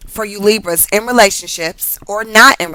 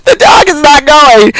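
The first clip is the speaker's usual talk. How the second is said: high, loud laughing speech; laughing hard; 7 semitones higher, 6 dB louder than usual. Real, not acted. Reader insists dog is not going, laughing hard. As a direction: crack up mid-sentence.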